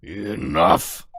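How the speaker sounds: a desperate outburst at the court; loud angry yell; furious shout. Acted. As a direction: shout it with everything, cornered. Cornered culprit snaps in court.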